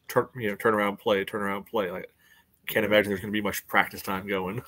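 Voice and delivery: ordinary talk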